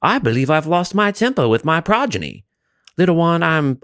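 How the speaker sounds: lightly voiced posh mom conceding; mock polite mom. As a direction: play an impossibly well-mannered mother. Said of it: "mom's gonna be like".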